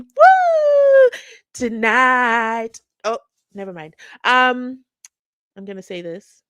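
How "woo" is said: loud, high whoop; excited whoop. Real, not acted. Host hyping members-only viewers, excited whoop. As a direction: let out a big woo.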